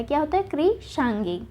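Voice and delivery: ordinary talk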